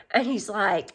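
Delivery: ordinary talk, nothing marked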